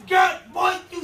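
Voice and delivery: put-on high, loud call at the kids; hammed-up parent scolding; mock scolding parent. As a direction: snap at them like a fussing mama.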